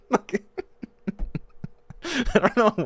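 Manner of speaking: high breathless giggle over the words; real laugh breaking through. Real, not acted. Streamer cracking up at his own troll.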